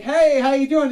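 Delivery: high, loud Jersey hello; a hammed-up Jersey greeter; Jersey greeting. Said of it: "you're gonna be like".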